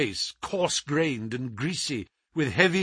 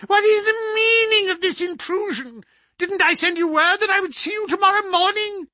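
much higher and louder, an outraged shriek; high screaming cry; 5 dB louder than usual. Acted. The scholar shrieking at the intruding doctor, high and loud. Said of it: "high, screaming voice".

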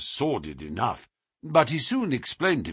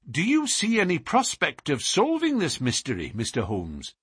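higher voice, mildly tart question; touch of asperity; 6 semitones higher than usual. A narrator's reading. Young lady breaking in on the detective's reverie, mildly sharp. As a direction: ask with restrained impatience. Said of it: "with a touch of asperity in her voice".

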